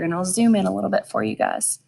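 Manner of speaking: usual speaking voice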